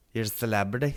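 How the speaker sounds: ordinary talk, nothing marked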